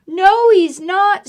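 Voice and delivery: put-on loud, high child's scream; screaming boy. Eddie warning Mom about the bear, loud. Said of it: "screamed Eddie".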